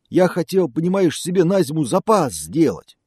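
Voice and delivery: loud, high whiny bear. Acted. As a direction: complain like a sorry bear. Bear complaining.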